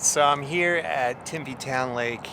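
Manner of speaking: ordinary talk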